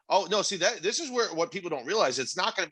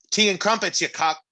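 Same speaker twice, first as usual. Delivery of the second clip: a hammed-up posh British jab; a lightly done British accent; mock British; 7 semitones higher, 3 dB louder than usual. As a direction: send up an English action hero. Mocking British Die Hard line.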